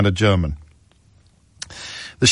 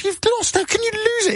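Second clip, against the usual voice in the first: disbelieving, pitched way up; shrill disbelief at the producer; incredulous high squeak. Retold or imitated. Retelling row with producer.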